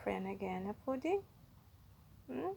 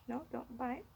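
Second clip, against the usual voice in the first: ordinary talk, nothing marked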